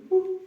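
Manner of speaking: short, very high monkey whoop. Retold or imitated. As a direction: yelp it like an excited monkey. Monkey getting closer.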